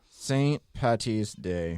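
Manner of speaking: mock Irish. Cohost joins the accent joke.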